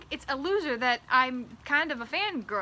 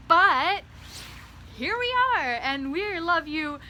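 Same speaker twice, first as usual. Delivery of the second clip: much higher, louder welcome; grand welcome; 7 semitones higher, 6 dB louder, slower than usual. Acted. Welcoming cheer.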